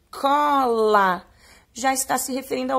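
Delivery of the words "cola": "Cola" stretched out, both syllables held; sounded-out reading. Shown as a demonstration. Teacher has pupils read along with her, sounded-out. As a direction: sound out "Cola" slowly and clearly. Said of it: "leia com a prof".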